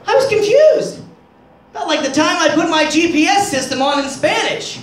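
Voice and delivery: ordinary delivery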